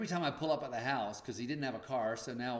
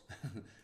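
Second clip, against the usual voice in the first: laugh; 7 dB quieter than usual. Real, not acted. After own joke.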